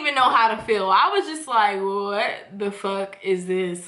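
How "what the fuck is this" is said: unimpressed inner thought. Retold or imitated.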